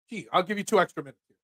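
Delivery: ordinary delivery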